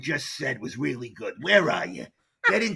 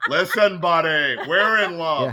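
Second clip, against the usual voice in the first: mock tough guy; 3 semitones higher, 7 dB louder, slower than usual.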